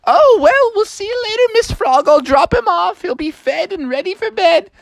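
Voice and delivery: high-pitched breezy mother voice; chirpy mom. Acted. Bucky's mom promising to drop him off, high and chirpy. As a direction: chirp a cheerful goodbye up high.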